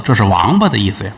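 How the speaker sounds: usual speaking voice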